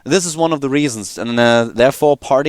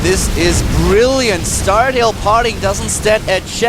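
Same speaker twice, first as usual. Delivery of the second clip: slow, drawn-out raised-pitch praise; excited play-by-play; 8 semitones higher, slower than usual. Real, not acted. Caster hails a brilliant execution, excited play-by-play. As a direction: stretch the words in high excitement.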